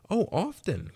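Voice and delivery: put-on excited little girl; eager cry. A narrator's reading. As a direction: burst out brightly like an eager child. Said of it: "cried the little girl".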